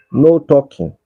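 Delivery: ordinary talk, nothing marked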